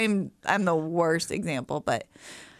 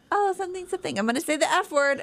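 high, light child imitation; teasing little boy; 10 semitones higher, faster than usual. Mom quoting her son on a bike ride, high kid voice. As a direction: mimic a boy threatening a naughty word. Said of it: "and he goes".

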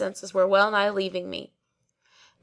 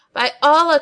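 high, loud exasperated oath; young man losing patience with the barber; loud cry; 7 semitones higher, 9 dB louder than usual. A narrator's reading. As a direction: burst out with the oath. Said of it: "cried I".

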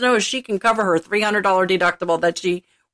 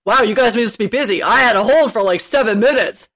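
complaining caller; 4 dB louder than usual. Retold or imitated. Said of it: "probably gonna yell at me".

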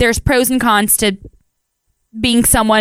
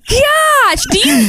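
exasperated shout; 13 semitones higher, slower than usual. Real, not acted. Exasperated at cohost laughing.

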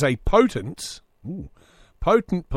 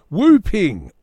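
name as a cough; 5 semitones higher, 7 dB louder than usual. Pretend. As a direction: bark the name out loud and high like a cough.

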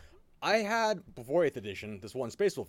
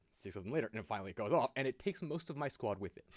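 normal talking voice

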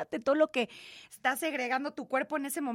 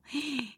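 soft awed gasp; 3 semitones higher, 6 dB quieter than usual. Real, not acted. Awe at motherhood.

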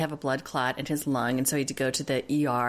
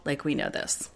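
ordinary talk, nothing marked

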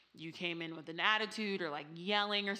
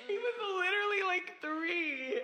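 laughing disbelief; 12 semitones higher, 4 dB louder than usual. Real, not acted.

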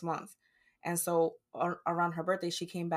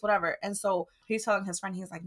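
ordinary talk, nothing marked

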